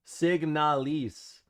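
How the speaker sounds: Signalis, slowly, with the last syllable as LEASE; drawn-out pronunciation. Shown as a demonstration. Streamer trying out the viewers' way, slow sounded-out pronunciation. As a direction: sound out "Signalis" slowly, ending in a long "ees". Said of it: "I'm not saying lease".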